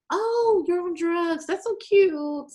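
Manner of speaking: high, sugary, slow dismissal; mock condescension. Brushing off talk of New York, high and patronizing. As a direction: patronize them sweetly. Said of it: "I'd be like".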